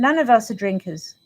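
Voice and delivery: ordinary delivery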